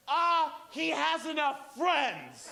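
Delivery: high, drawn-out exclamation; retold public outburst. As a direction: stretch it out high and slow. Outburst in a crowded Starbucks.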